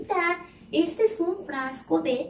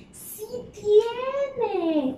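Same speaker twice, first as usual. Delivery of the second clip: un "¡Sí tiene!" mucho más agudo y animado; sorpresa alegre; 10 semitones higher than usual. Acted. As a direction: celebra el hallazgo para los niños. Maestra descubre la A en su nombre. (exclamación de maestra, aguda y entusiasta)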